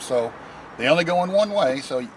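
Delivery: ordinary delivery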